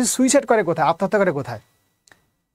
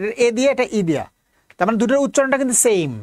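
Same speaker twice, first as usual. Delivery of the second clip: ordinary delivery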